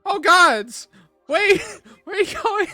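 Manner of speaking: shrill, loud, fast panic; the ledge guy screams in panic as the bard leaps; panicked NPC. Acted. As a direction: screech after someone who just jumped. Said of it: "he goes".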